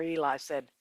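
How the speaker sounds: usual speaking voice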